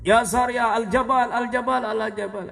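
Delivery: high, loud calling out; shouted command. Retold or imitated. Umar calling out mid-sermon to his commander, loud call. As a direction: call out across the battlefield. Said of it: "beliau teriak tengah khutbah".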